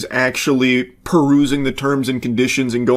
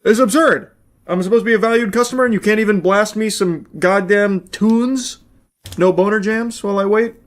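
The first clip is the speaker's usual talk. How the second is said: indignant rant; 7 semitones higher than usual. Real, not acted. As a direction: rant at the empty hold line. Ranting at the silent hold line.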